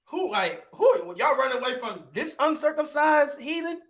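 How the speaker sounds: high, laughing disbelief; can't believe they're running from him; incredulous David. Retold or imitated. David mocking the fleeing Israelites, laughing in disbelief. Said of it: "And he like".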